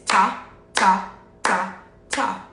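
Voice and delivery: four separate, crisp "ta" syllables; quarter-note counting. Shown as a demonstration. Teacher contrasts quarter notes with whole note, separately struck counting. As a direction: give every beat its own "ta". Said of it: "it would sound like this".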